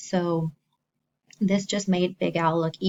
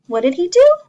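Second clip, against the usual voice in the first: high loud what did he do; shocked-for-the-kids question; hammed-up alarm; mock dismay; 11 semitones higher, 13 dB louder, faster than usual. After sneeze, mock dismay.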